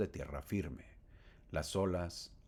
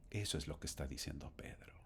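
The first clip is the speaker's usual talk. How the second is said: whispered aside; 6 semitones lower, 10 dB quieter, faster than usual. Acted. Whispered aside after quote.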